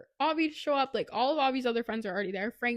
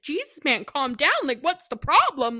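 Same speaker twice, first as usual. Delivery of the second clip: louder, raised voice calling out; exasperated; 4 semitones higher, 11 dB louder than usual. Retold or imitated. Gutty at the party telling Kieran to calm down, loud. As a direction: tell him to calm down over the noise.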